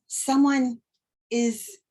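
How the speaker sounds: ordinary talk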